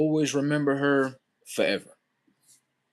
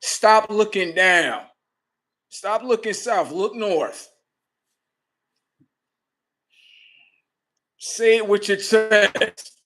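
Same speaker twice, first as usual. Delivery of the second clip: slow, louder, emphatic commands; firm fatherly push; 7 semitones higher, 3 dB louder, much slower than usual. Retold or imitated. Father urging his son to speak up, slow and firm. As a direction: coach him slowly and firmly to speak up.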